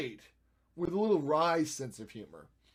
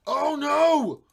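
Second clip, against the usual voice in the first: high, loud cry of alarm; loud dismay; 13 semitones higher, 14 dB louder than usual. Real, not acted. Editor sees a mistake on screen, loud dismayed cry. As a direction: wail it out, high and long. Editing step goes wrong.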